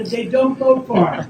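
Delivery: slow, drawn-out mock refinement on "they don't go far"; mock sophisticated. Shown as a demonstration. Joking to the crowd about electric cars, slow mock-sophisticated. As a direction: stretch "they don't go far" out like a posh joke. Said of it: "I wanna say it in a very sophisticated manner".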